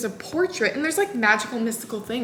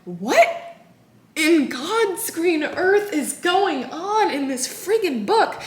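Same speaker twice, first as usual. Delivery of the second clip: exasperated disbelief; 6 semitones higher, much slower than usual. Retold or imitated. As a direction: stretch every word in disbelief. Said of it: "I'm like".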